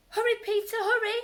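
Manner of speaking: high, loud urging cry; urgent. Acted. Sparrows urging the trapped rabbit, high and loud. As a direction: hurry him along. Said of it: "urged some friendly sparrows".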